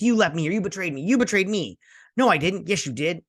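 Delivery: high, hammed-up accusing bicker; exaggerated childish back-and-forth squabble; mock quarrel. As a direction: accuse and deny in playful indignation. Acting two characters' betrayal quarrel.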